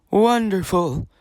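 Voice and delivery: high, loud burst of delight; cried out. A narrator's reading. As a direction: exclaim it with a joyful jolt. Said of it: "the red-haired king cried out".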